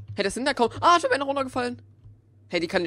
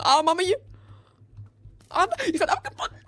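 high hammed-up call for mommy; mock panic cry; 8 semitones higher, 4 dB louder than usual. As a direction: play the scared child calling for help. Enderman greift an, ruft Mami. (gespielter panischer Hilferuf)

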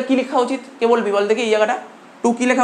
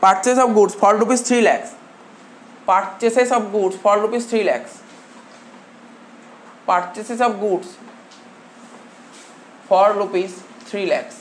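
each word drawn out slowly for notes; slow dictation; much slower than usual. Shown as a demonstration. Teacher to class taking notes, slow dictation. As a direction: dictate slowly so students can write. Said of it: "লিখেছিস তো?".

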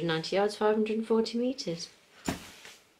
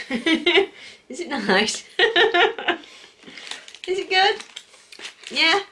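laughing; 11 semitones higher, 3 dB louder, slower than usual. Real, not acted. Watching kids taste sour candy.

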